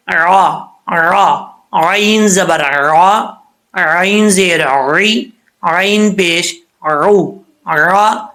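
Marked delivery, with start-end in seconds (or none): 1.7-7.3 عین زبر را, عین زیر ری, عین پیش رو stretched slowly with a throaty عین; slow syllable drill. Shown as a demonstration. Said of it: "کیسے؟".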